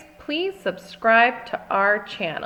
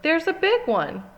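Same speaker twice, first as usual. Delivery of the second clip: excited; 8 semitones higher than usual. A narrator's reading. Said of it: "says Daddy Pig".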